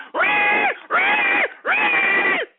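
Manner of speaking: shrieked pig squeals, very high and loud; mock pig squeal.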